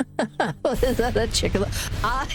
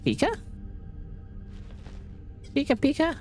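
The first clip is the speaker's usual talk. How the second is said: high little Pikachu chirp, mock cute; lightly voiced Pikachu; 9 semitones higher, 6 dB quieter, faster than usual. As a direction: chirp it like a pocket monster, questioning. Mimics Pikachu's cry in game.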